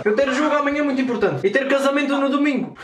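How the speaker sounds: put-on broken-Portuguese Russian accent. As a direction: play a lost Russian, heavy accent. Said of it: "com a famosa voz de russo".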